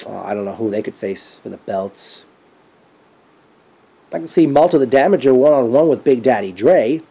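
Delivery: ordinary talk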